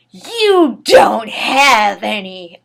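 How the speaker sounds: loud, slow, dragged-out shout; a slow, loud, unhinged cry; mad yell. Acted. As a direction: yell it out madly and slowly. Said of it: "I yelled in madly".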